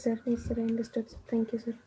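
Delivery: ordinary talk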